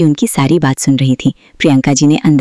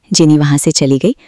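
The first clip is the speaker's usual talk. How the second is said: ordinary delivery, nothing marked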